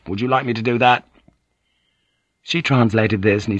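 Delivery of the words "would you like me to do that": fast, hurried question; in a rush. Acted. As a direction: rush the words out to gain time. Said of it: "he said in a rush".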